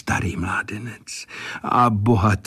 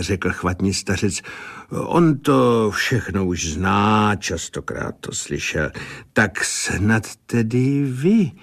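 usual speaking voice

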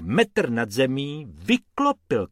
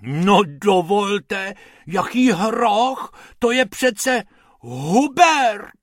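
slow, high, loud huffy protest; outraged lady voice; indignant; 9 semitones higher, 6 dB louder, slower than usual. A narrator's reading. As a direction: take offence, drawn out. Offended lady defends her Hubert.

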